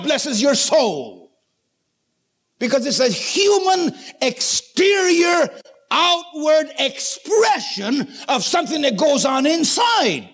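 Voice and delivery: high-pitched, emphatic sermon delivery; impassioned preacher, pitch riding high; preaching at full force. Real, not acted. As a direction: drive each point home to the congregation.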